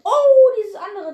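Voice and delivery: loud, slow surprised oh; surprised exclamation. Real, not acted. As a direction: burst out in surprise. Surprised by game event.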